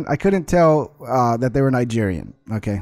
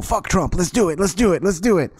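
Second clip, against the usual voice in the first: higher, hyped-up mock chant; mock rallying; 5 semitones higher than usual. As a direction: play plotters egging each other on. Imagined plotters hyping each other up.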